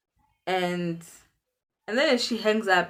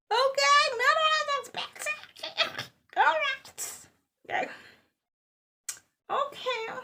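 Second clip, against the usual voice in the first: much higher, squeaky disbelief with a laugh; stunned squeak. Real, not acted. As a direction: squeak it out, totally thrown. Stunned reaction to calm mom.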